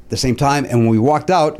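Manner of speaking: ordinary delivery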